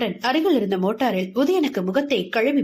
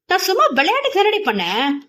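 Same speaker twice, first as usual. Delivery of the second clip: higher, pouting, sulky excuse; 8 semitones higher, 3 dB louder than usual. Acted. Boy sulks at his scolding friend, pouting excuse. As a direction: sulk it with a pout. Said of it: "உதட்டை பிதுக்கினாள்".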